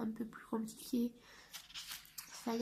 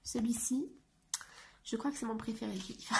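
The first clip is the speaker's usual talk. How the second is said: usual speaking voice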